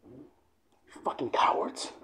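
slow, menacing whisper; hushed, very slow challenge; whispered taunt. Real, not acted. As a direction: whisper the insult, drawing it out. Taunting enemies to step up.

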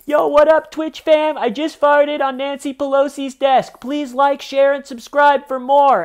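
high, loud hype-streamer shout; hyped streamer. Retold or imitated. Streamer greeting his Twitch fam, loud and high. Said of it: "compilations of live streams where it's like".

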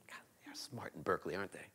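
teasing whisper to the crowd; whispered aside. Real, not acted. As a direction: whisper it as a joke.

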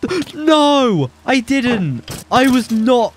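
high, loud disbelieving yells; panicked high shouts of no; shocked shouting. Real, not acted. Shocked outburst at game event.

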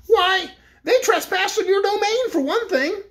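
high, slow officious voice; a fussy toy bear accusing, up high; the corporal naming the crime, high and slow; Corporal Waddle. A narrator's reading.

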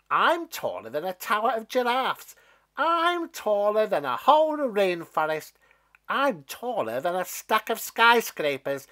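loud, high bragging voice; a mean stalk bragging to Jack; boasting stalk. Acted. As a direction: show off shamelessly.

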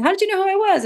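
Barb wary of a stranger; mock puzzled Barb. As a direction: Barb in the elevator, high mock surprise. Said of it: "And she said".

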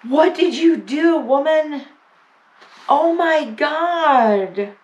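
high, loud cries of disbelief; real shock, pitched high, Oh my God drawn out slowly; stunned disbelief. Real, not acted. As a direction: blurt it out, overwhelmed and amazed. Overwhelmed by friend's gift.

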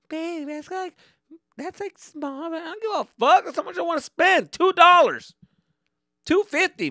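put-on high, shy girlfriend voice that turns loud and defiant. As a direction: squeak it high like an embarrassed girlfriend, then belt it. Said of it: "She gets all shy".

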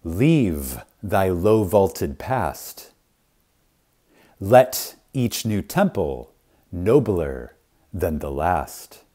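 slow, solemn poem reading; measured, reverent verse, voice sinking at the end; poem recital. Acted. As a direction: recite it slowly like a closing benediction.

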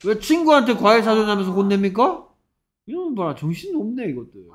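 high-pitched incredulous challenge; exasperated disbelief. Real, not acted. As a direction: question it like it's absurd. Teacher incredulous at students' wrong answer. (어이없어하며 높은 목소리로 따지듯)

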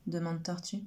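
usual speaking voice

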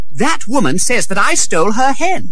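put-on indignant young boy; protesting boy. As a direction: protest the accusation in a high voice. Accused boy protests to rescuer.

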